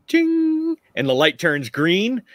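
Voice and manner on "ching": high ching chime; chime noise. Acted. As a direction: imitate a bright chime. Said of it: "you hear a".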